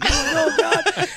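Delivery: shrieking oh no; mock shriek. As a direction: squeal in fake fright. Shrieking reaction to warning.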